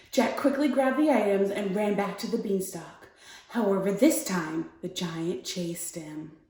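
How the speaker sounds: calm, measured bedtime-story read; story narration. A narrator's reading. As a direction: read a fairy tale gently to young children. Reads a story aloud.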